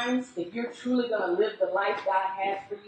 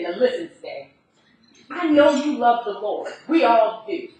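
louder, higher insistent brother pressing hard; pleading brother; 6 semitones higher, 7 dB louder than usual. Retold or imitated. Twin brother pressures his sister to fly home, loud and urgent.

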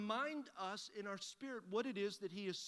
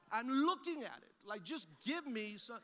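frustrated; 2 semitones higher, 5 dB louder than usual. Retold or imitated. Frustrated customer fuming about the bottles in plain sight, exasperated. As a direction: act out fed-up impatience, starting loud. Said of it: "have you ever just been frustrated".

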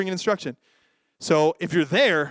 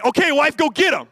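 higher and louder, hammed-up scared husband pushing his wife forward; mock cowardly husband; 8 semitones higher, 6 dB louder than usual. Husband sending his wife at the burglar, mock panicked. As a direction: play the coward who sends his wife to face the burglar. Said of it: "do you say".

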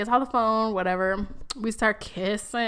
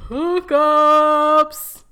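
loud, high, drawn-out reveal; big topic announcement; 6 semitones higher, 9 dB louder than usual. Pretend. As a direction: belt it out like a show announcer. Dramatic announcement of the episode's topic.

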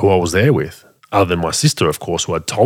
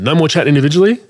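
higher, louder, laying down the law; firm raised command; 8 semitones higher, 5 dB louder, much faster than usual. Retold or imitated. Calling both down to the lounge room, loud and firm. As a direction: say it like an order. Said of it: "I said".

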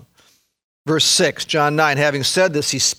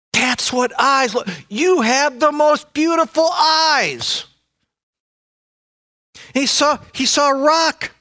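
high, hushed-to-crying-out amazement at first sight; a man seeing for the first time, overwhelmed with wonder; awed wonder; 9 semitones higher than usual. Acted. Preacher voicing the healed blind man, amazed wonder.

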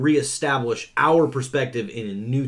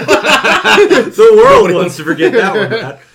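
high, slow, through laughter; laughing; 10 semitones higher, 14 dB louder, much slower than usual. Real, not acted. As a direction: say it while cracking up. Laughing mock dismissal.

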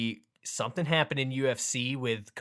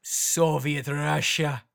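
slow, louder barked fake Russian; angry mock Russian; 3 semitones higher, 4 dB louder, much slower than usual. Host doing a gruff fake Russian for his cohost, slow angry mock accent. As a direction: bark it like an angry Soviet officer. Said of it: "much angrier than the other languages".

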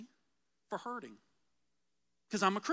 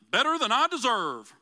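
louder stock reply, hammed up; mock cliché answer; 6 dB louder than usual. Pastor mimics the Dave Ramsey answer, hammed up. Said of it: "that's the old Dave Ramsey one".

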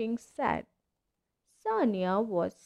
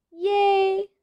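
much higher, loud squeal; excited cheer; 9 semitones higher, 7 dB louder than usual. Acted. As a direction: cheer out gleefully. Cheer from hiding.

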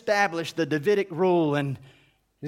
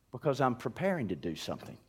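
much lower and softer, quick and calm; mother quietly reassuring the impatient child; soft reassuring answer; 10 semitones lower, 10 dB quieter, faster than usual. Acted. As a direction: hush the child gently.

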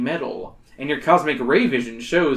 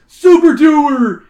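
much higher, louder, slow drawn-out call; crying out; 11 semitones higher, 12 dB louder, much slower than usual. Acted. Workman crying out for the superhero. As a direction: call him from across the site. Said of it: "he cries".

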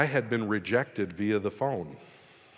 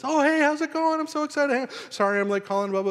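much higher bubbly imitation of his fiancée; imitating his fiancée. His fiancée calling late, bright high imitation. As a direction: play her chirpy and upbeat, pitched way up. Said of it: "She says".

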